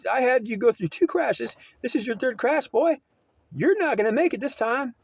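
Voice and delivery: high scolding divine voice building in pitch; God scolding. Retold or imitated. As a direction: lecture the boy as an angry God, rising to a squeal on boy. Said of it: "He's saying".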